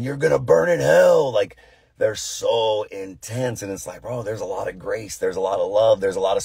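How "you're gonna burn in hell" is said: raised, louder street-preacher condemnation; hammed-up holler; mock scream. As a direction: imitate a fire-and-brimstone heckler. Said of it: "and they're screaming".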